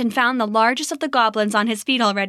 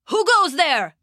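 higher, alert guard call; guard's challenge; 6 semitones higher than usual. Acted. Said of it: "cried a guard".

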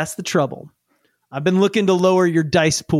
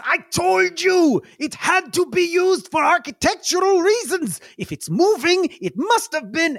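very high, triumphant shout; excited yelling; 12 semitones higher than usual. Acted. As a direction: crow that you were right. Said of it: "He immediately yells".

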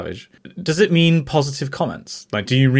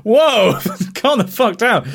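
mock alarm; 9 semitones higher, 4 dB louder, slower than usual. Comic reaction to retold answer.

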